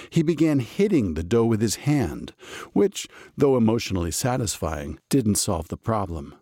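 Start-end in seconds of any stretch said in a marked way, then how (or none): none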